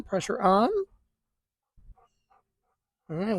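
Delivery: ordinary talk, nothing marked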